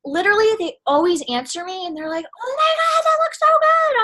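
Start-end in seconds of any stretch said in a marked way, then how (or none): 2.3-4.0 high squealing excitement, much louder; gushing customer. Retold or imitated. Customer gushing over the product photos, high excited squeal.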